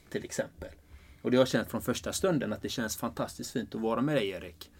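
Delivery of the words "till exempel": fading into a whisper. Real, not acted. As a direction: let the words sink to a whisper. Trailing example, voice drops out. (viskande avslutning)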